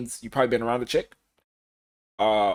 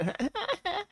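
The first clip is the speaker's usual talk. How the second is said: high, soft mock giggle; imitated giggle; 9 dB quieter than usual. Imitating the woman saying good night on the phone, high little giggle. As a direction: giggle lightly like she did at the end. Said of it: "And she giggled at the end".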